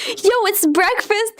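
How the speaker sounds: normal talking voice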